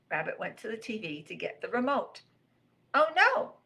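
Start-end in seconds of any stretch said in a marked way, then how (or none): 2.9-3.6 high, loud moan of alarm; moaned dismay. Acted. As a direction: wail it in dismay. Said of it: "moaned Rabbit".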